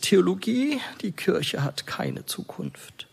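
imitating a mildly despairing father, high questioning first word, then dropping away; slightly despairing. Father doubting his child's choice of theology, mildly despairing. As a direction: raise the first word in disbelief, let the rest sink softly. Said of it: "sagte oft etwas verzweifelt".

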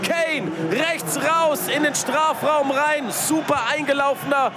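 high and elated, winding down; excited replay call. Real, not acted. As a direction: still buzzing after the goal. Replaying the goal, still elated.

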